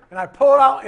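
loud, high buildup to the punchline. Acted. Shepherd pulling out the rod, loud and high. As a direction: belt out the big moment.

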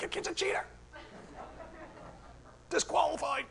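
high, soft, strained protest; mock outraged tattling. Straggling runner tattling on a cheating kid, mock outrage. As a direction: squeak out a powerless complaint. Acts outraged loser far behind.

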